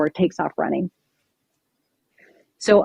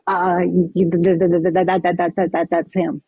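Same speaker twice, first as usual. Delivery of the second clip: shaking. Retold or imitated. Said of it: "instantly I'm shaking".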